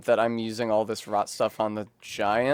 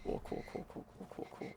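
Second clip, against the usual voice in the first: whispered; 18 dB quieter, faster than usual. Real, not acted. Repeated murmured cools.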